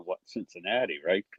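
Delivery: ordinary talk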